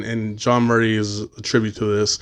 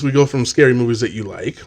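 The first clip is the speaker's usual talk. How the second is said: ordinary talk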